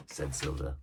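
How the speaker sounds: plain reading voice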